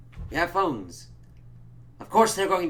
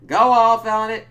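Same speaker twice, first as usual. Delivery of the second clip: sarcastic cheer; 9 semitones higher, 12 dB louder than usual. Retold or imitated. Sarcastic acted reaction.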